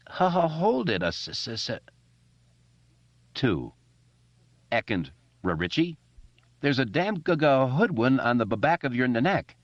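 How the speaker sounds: put-on high, stammering boy's voice; stuttering Bill. A narrator's reading. Bill warning Richie about a mosquito, stammering high.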